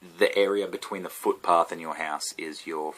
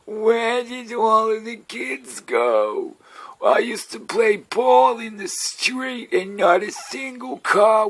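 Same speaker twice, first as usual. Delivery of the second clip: put-on grumpy old man; 14 semitones higher, 6 dB louder, much slower than usual. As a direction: drag it out like a ninety-year-old complaining. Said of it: "I sound like a ninety-year-old man".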